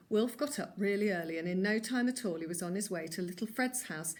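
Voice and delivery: plain reading voice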